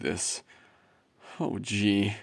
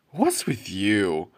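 ordinary talk

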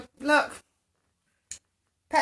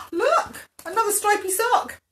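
high, thrilled exclamation; excited discovery; 9 semitones higher, 4 dB louder than usual. Acted. Sock spots another sock, excited. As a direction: point it out with high-pitched delight.

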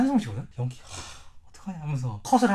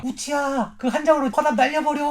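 cheering sidekick; 9 semitones higher, 3 dB louder, slower than usual. Retold or imitated. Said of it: "초랭이가".

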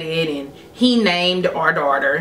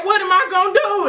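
high-pitched incredulous echo; acted-out outraged question; imitated upset; 13 semitones higher, faster than usual. Dad repeating boyfriend's answer, upset. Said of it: "he just got so upset".

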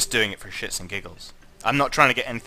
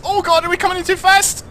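much higher, louder panicked cry; 9 dB louder than usual. Real, not acted. Streamer shrieking at his lander, panicked and loud. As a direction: shriek it in real alarm.

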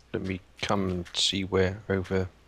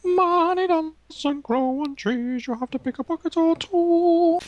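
silly high voice; 5 dB louder than usual. Pretend. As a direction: squeak it like a cartoon. Silly high sing-song voice.

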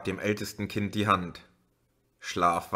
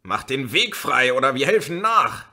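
louder and higher, threatening; angry yell; 4 semitones higher, 6 dB louder than usual. A narrator's reading. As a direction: yell from the jammed cars. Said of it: "Eine Stimme schrie". (wütend geschrien)

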